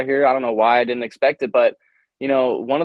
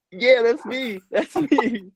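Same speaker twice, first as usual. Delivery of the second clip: high-pitched mock Aussie accent; playful, high Australian-accent impression; 8 semitones higher, much slower than usual. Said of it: "my Australian accent".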